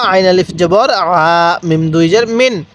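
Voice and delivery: ordinary talk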